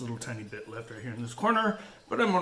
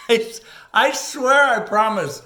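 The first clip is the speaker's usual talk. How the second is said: loud emphatic plea; 8 semitones higher, 10 dB louder than usual. Real, not acted. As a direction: stretch it out, begging them to believe. Pleading his promise to viewers.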